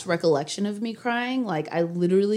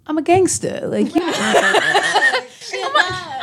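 laughing; 12 semitones higher, much slower than usual. Real, not acted. Laughing while calling herself tough.

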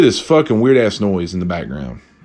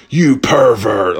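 slow, drawn-out disgusted accusation; scolding old lady; much slower than usual. Retold or imitated. Old lady glares at the narrator, in a slow scolding voice. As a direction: drag it out like a disapproving old lady. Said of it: "old lady just looks at me and".

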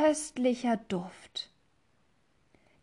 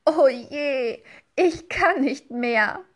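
laughing moan; 4 semitones higher, 5 dB louder, slower than usual. Acted. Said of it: "hält sich den Bauch und jammert".

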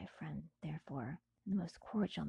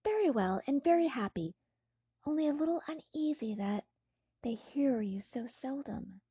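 a touch higher, brighter friend chatting; lightly voiced cheerful friend; 3 semitones higher, 5 dB louder than usual. Clerval telling Frankenstein of his father, lightly voiced. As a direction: speak as an eager young friend.

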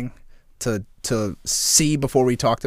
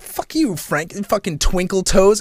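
much higher, slow teasing jab; mocking wife's insult; 7 semitones higher, 4 dB louder, slower than usual. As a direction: imitate her playful cussing at him. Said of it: "she's like".

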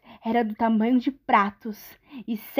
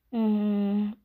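plain reading voice

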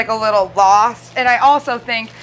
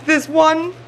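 ordinary talk

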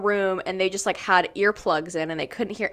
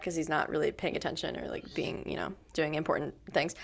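breathy unvoiced aside, low-key; whispered aside; 4 semitones lower, 10 dB quieter than usual. Real, not acted. To cohosts, a joking aside about Topher's text, whispered. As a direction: whisper it behind your hand.

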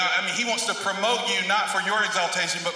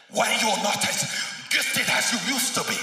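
imitated African preacher's accent, pitched higher; 4 semitones higher than usual. Pastor mimicking a guest speaker from Zimbabwe, in an African accent. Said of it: "he's saying it in his African accent".

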